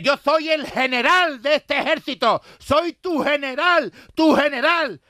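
high, loud, slow proclamation; furious general. Retold or imitated. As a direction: pound each word, high and loud. General asserting his rank.

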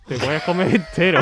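stifled giggles. Real, not acted. Joking threat amid laughter.

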